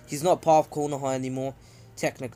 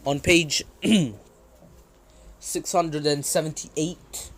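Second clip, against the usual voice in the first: ordinary delivery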